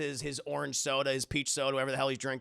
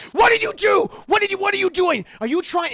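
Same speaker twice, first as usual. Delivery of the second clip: high, loud accusing shout; acted enraged yelling; furious Howard; 14 semitones higher, 10 dB louder than usual. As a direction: explode and stumble over the questions. Acted host explodes at guests.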